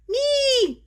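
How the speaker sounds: put-on high, loud little-girl "Me"; little sister begging to join in, high and loud; eager little Nika. Younger sister pleading to her big brother, high loud voice.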